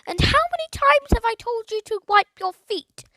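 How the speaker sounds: high, loud nagging squawk; scolding parrot. A narrator's reading. Parrot nagging the boy about his feet, high squawk. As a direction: nag like a cross old aunt.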